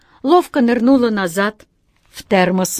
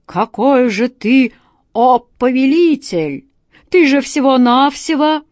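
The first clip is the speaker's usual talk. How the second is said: roaring with indignation; 4 semitones higher, 5 dB louder than usual. A narrator's reading. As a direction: roar it out, outraged genie. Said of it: "взревел от негодования".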